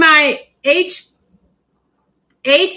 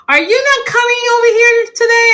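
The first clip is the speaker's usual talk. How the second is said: quick, high mock mom voice; 8 semitones higher, faster than usual. Mom calling to ask why she is not coming, mock-voiced. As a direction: play mom, higher and hurried. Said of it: "My mom called me yesterday and said".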